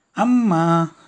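loud, high happy cry of Amma; little girl greeting her mother; eager call. A narrator's reading. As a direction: run to her calling out. Child running to mother.

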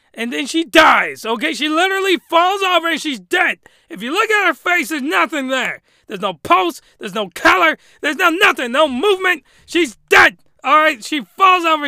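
shouting at the absurd scene; incredulous shouting. Real, not acted. Ranting to viewers that she just died, loud disbelief. Incredulous rant about her death.

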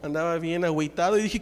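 ordinary delivery

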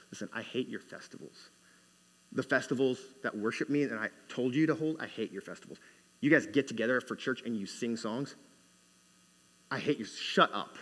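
low, hushed voice of God; quiet rebuke. Acted. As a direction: rebuke softly and gravely. God rebuking his people, as retold.

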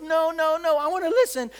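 high, insistent plea; protesting girl. Retold or imitated. Girl to the friend pulling her away, insistent protest. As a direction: imitate a girl resisting, pitch jumped up.

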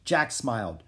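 plain reading voice

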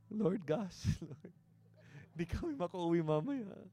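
laughing. Real, not acted. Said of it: "laughing at himself".

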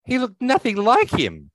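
can't believe the transformation; drawn-out astonished protest; stunned disbelief. Real, not acted. Stunned by actor reveal.

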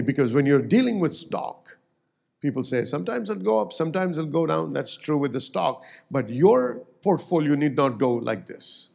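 ordinary talk

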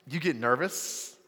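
usual speaking voice

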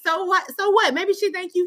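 mock sassy retort. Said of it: "And I was like".

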